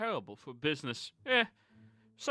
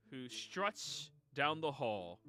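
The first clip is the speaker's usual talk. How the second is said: ordinary talk, nothing marked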